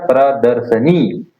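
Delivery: प्रदर्शनी said slowly, short नी at the end; slow correct form. Shown as a demonstration. Teacher quizzing students, answering slowly himself. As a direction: sound out प्रदर्शनी for the class. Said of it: "क्या होता है?".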